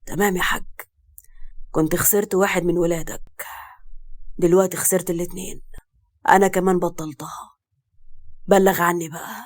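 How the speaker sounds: low, restrained, wounded anger; tearful anger. A narrator's reading. Hurt son breaking with his father, understated tearful anger. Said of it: "وهو عينه فيها دمعة غضب".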